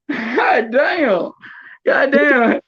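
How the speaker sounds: high, laughing "god damn" between giggles; laughing exclamation. Real, not acted. Mocking a friend's boy to his buddy, laughing high. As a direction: crack up while you say it.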